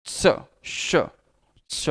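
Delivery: श hissed with tongue on the palate; demonstrating sounds. Shown as a demonstration. Teacher demonstrating श to students. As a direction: hold the tongue to the palate and hiss श. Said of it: "ठीक वैसे ही हम मान लेते हैं".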